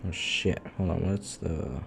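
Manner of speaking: normal talking voice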